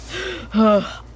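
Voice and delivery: overfull, acted sigh; weary puff of breath; heavy sigh. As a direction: let out a long breath after too much food. Daddy Pig regrets the spaghetti.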